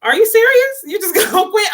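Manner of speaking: high, loud incredulous squeal; shocked disbelief. Retold or imitated. Family reacting to her quitting, high stunned disbelief. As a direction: act out stunned family who can't believe it. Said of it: "They were like".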